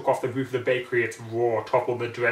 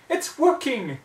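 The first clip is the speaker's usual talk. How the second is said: much higher, loud cheer of relief; high excited shout; triumphant cry; 13 semitones higher, 5 dB louder, slower than usual. A narrator's reading. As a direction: celebrate the spell working. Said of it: "they cried out in triumph".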